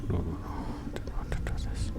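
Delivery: breathy unvoiced whisper under his breath. Real, not acted. Streamer dreading the choice he must make, whispering. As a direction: murmur it to yourself so no one hears.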